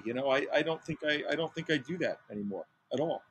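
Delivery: usual speaking voice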